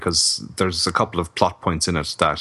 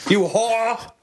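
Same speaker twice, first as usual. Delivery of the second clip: very high shrill mock shriek; shrieking mean girl; slower than usual. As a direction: shriek the insult like a catty girl. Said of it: "Did one of them go".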